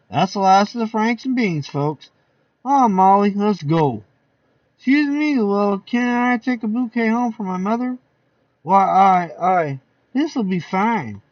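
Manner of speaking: high, lively guest voices; party guests. Acted. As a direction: chatter like a rowdy party guest.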